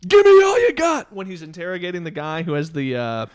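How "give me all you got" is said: very high, full-volume yell; hammed-up interrogator's all-out shout; mimicked movie scream. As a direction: scream it out of nowhere at a suspect. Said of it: "out of nowhere screams at him".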